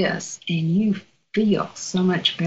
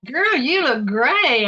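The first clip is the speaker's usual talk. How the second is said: higher, slow, drawn-out telling-off; retold motherly chiding, pitched up; mock scolding; 6 semitones higher, slower than usual. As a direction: scold her playfully, drawing it out. Retells scolding her young doctor.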